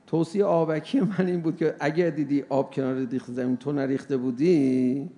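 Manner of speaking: usual speaking voice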